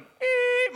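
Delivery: high sharp beep sound; imitated beep. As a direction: squeak out the phone's beep.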